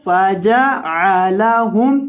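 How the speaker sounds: ordinary delivery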